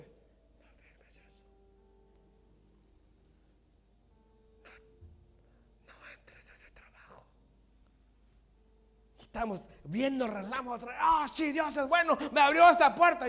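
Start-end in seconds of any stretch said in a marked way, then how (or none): 0.0-7.3 breathy, barely audible whisper; God's whispered warning. Acted. God warning a believer privately, whispered. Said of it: "Pero no está gritando, no te dice". 10.9-13.3 high, loud mock testimony; excited believer. Believer praising God for an open door, excited.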